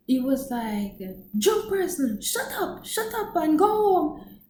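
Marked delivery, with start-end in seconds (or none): 1.4-4.4 much higher mock snap; mock scolding. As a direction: shoo her home. Coach telling drunk off.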